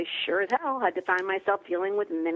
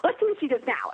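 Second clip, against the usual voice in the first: amused gods; 6 semitones higher than usual. Retold or imitated. Gods in lawn chairs mock her book plan, amused and laughing. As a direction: tease a mortal like a cute pet. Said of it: "they watch us and they go".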